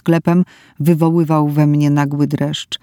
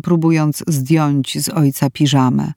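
plain reading voice

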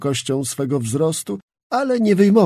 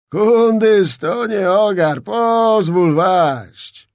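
higher, slow pompous voice, "pozwól" dragged out; fat nobleman; 5 semitones higher, 5 dB louder, much slower than usual. A narrator's reading. Zagłoba offering service to Skrzetuski, pompous slow drawl. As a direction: ingratiate yourself like a boastful old noble. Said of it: "mówił dalej".

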